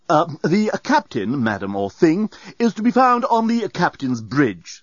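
slow, condescending doorbot; cold. A narrator's reading. Doorbot answering madam with killing logic, cold. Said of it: "said the doorbot coldly".

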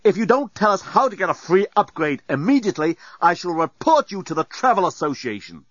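high, forceful bluff; indignant threat. A narrator's reading. Dan threatening the robot over a free upgrade, indignant. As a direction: pitch it up as an official complaint.

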